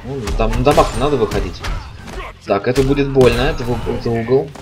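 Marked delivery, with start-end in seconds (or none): none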